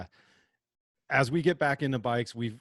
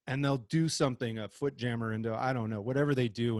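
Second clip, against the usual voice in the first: ordinary talk, nothing marked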